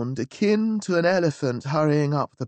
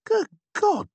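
startled exclamation, pitched much higher; surprised cry; 3 dB louder than usual. Acted. Narrator stunned by the valet's knowledge, crying out high. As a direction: shoot up high in sheer astonishment. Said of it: "I cried".

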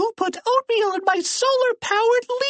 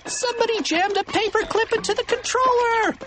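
put-on very high, frantic kid's wail; acted high-pitched panic; panicked cry. A narrator's reading. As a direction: cry out in helpless alarm. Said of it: "cried Donnie".

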